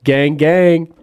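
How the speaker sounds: loud, high gang call; mock hype-man holler; mock rapper shout. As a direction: shout it like a rap ad-lib.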